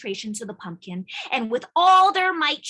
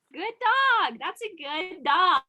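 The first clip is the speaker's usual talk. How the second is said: praising the dog; 7 semitones higher, slower than usual. Real, not acted.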